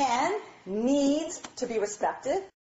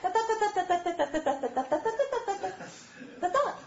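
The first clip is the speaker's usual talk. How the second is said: high babbling chatter ignoring him; sing-song nonsense chatter; chatter babble; 9 semitones higher than usual. Pretend. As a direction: keep gossiping while he walks in.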